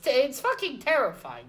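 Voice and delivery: usual speaking voice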